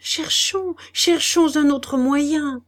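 slow, high, feverish urging. Acted. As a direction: repeat it in a fever. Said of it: "répéta-t-il fiévreusement". (répéter fiévreusement, lentement et plus haut)

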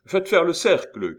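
high, loud officer's command; imperative shout. A narrator's reading. Officer ordering the crowd back, imperative shout. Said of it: "cria la voix impérative d'un officier".

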